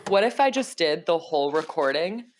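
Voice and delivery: ordinary delivery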